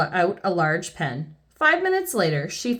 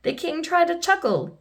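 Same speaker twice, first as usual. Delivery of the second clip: ordinary delivery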